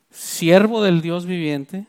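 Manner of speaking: "siervo" lifted, then each word drawn out; slow solemn emphasis. Shown as a demonstration. Preacher stresses the king's title for Daniel, slow and solemn. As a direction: dwell slowly on "siervo del Dios viviente".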